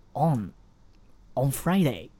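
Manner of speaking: On clipped short and firm; short strong on. Shown as a demonstration. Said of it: "On一定要发得短促有力". (On短促有力)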